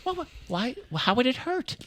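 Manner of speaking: high-pitched, halting mom voice, slowing at the end; sweetly naive mother. Retold or imitated. Mother urging son to call Stallone, high and innocent. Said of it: "she honestly was like".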